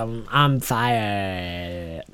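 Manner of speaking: very slow, low and soft; mock fire voice. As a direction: drag it out low, as a face in the flames. Mock actor playing the fire face.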